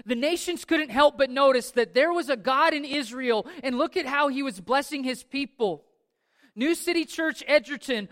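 rousing sermon voice; fervent preaching. Acted. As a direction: preach it out full force to the congregation. Preaching at full force.

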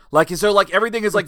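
ordinary talk

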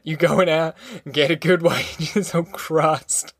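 words breaking into a giggle; mocking Pa's plan, talking through giggles; giggling. A narrator's reading. Ragged man mocking the family's plans, giggling. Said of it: "The giggling started again".

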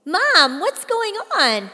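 put-on scared teen calling to her mother; frantic call. A narrator's reading. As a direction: shout down the hallway for answers.

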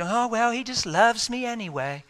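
high, airy sing-song mockery; mock flighty. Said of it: "Don't get so flighty".

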